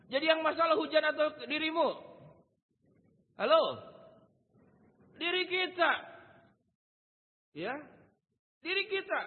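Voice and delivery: high, loud call for the answer; emphatic, high-pitched preacher; full-force preaching. Real, not acted.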